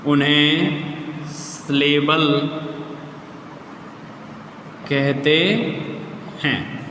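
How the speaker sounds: very slow, word by word with long pauses; slow dictation. Real, not acted. Teacher writing out a definition for pupils, slow dictation. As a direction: dictate it piecemeal as you write.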